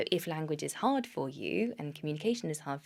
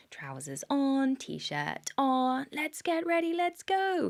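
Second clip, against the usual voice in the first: put-on high, slow sing-song for a toddler; therapist voice; 9 semitones higher, 3 dB louder, much slower than usual. Shown as a demonstration. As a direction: coax a small child brightly through dressing. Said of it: "I can't turn off my therapist voice".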